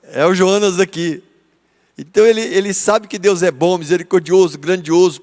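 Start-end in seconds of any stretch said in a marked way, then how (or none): none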